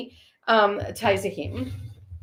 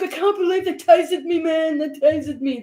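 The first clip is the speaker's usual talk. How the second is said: higher-pitched mock whine; complaining man; 6 semitones higher than usual. Ian in the background after being tasered, mock aggrieved complaint. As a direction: imitate a man protesting in disbelief. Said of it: "He's like".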